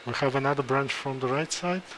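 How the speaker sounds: ordinary talk, nothing marked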